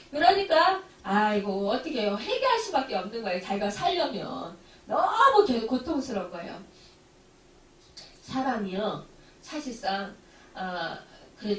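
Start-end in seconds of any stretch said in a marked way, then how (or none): none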